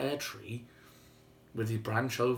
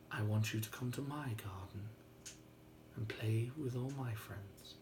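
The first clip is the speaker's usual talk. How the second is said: hushed and gentle; 2 semitones lower, 9 dB quieter than usual. A narrator's reading. Boy softly invites the giant to Paradise, whispering. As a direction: hush him tenderly, barely a breath. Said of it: "the little boy just said".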